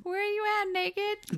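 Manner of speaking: high, squealed searching call; mock calling out. [name] searching for naked Sarah, high mock call. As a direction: call out high, hammed-up and silly.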